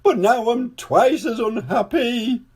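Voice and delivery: high, loud, wailing king voice. A narrator's reading. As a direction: wail it to the ceiling. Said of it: "he wailed".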